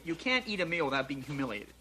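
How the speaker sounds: ordinary talk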